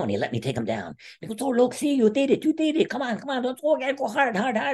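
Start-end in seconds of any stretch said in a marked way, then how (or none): 1.4-4.7 very high, urgent cheering; excited coach imitation. Coach cheering the wrestler's takedown, very high imitated excitement. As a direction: cheer and push him in a squeaky-high voice.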